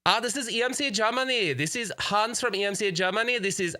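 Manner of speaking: high mock German drifting toward Spanish; badly faked German accent, pitched high; slipping German accent. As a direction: attempt a German caller and let it slide Spanish. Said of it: "like, a Spanish accent".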